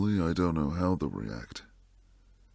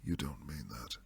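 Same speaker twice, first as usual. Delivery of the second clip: soft unvoiced whisper, much softer; 5 semitones lower, 9 dB quieter than usual. To the listener who wants to stay, a hushed whisper. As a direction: breathe it out, hushed and dismayed.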